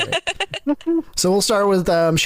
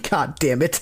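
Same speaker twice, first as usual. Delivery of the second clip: usual speaking voice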